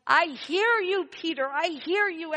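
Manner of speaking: high, loud call of reassurance; loud and high, answering a child's plea; Santa crying out. Retold or imitated. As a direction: call out to the boy as if he could hear. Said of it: "The Santa in me cried".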